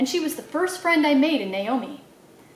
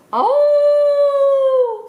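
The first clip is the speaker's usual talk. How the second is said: high, loud drawn-out howl; dog howl; 13 semitones higher, 7 dB louder than usual. A narrator's reading. The dog answers the preacher with a long loud howl. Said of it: "said Winn-Dixie".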